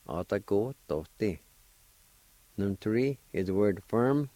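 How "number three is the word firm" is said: ordinary talk, nothing marked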